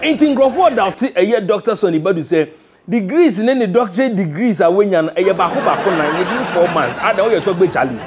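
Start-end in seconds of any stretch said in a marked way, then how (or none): none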